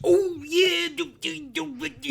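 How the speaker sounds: high, loud wrestler impression; Macho Man Randy Savage. Friend teasing another's impression, high wrestler parody. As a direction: belt it up high like a hammed wrestler. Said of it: "He sounded like Macho Man Randy Savage".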